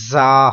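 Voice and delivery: زا with a strong, hissing whistle on the z; whistling z. Shown as a demonstration. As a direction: hold a sharp buzzing z in زا, loud. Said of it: "آواز سن رہے ہیں سیٹی کی".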